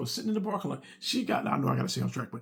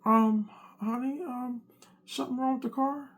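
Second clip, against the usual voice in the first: hesitant wife; 8 semitones higher than usual. Retold or imitated. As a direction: draw the ums out nervously. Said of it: "she's like".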